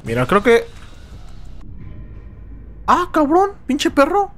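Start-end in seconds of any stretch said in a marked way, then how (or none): 2.9-4.3 startled curse. Real, not acted. Killed suddenly by enemy.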